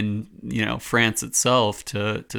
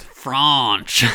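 hammed-up French accent on one word, louder; mock French accent; 4 semitones higher, 4 dB louder than usual. Cohost jokes back in a mock French accent. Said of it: "outrageous French accent".

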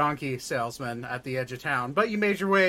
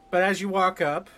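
ordinary delivery

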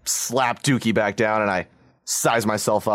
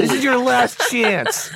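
mock threat; 11 semitones higher, slower than usual. Bully threatens, laughing.

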